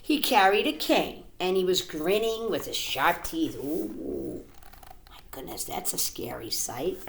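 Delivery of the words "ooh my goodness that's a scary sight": soft, breathless ooh; scared whisper. A narrator's reading. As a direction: hush it in mock fear. Reacting to scary fox.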